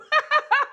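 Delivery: high, loud laughing burst; real cackle. Real, not acted. Woman telling viewers free will is theirs, cackling. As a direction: burst out laughing after your own slogan.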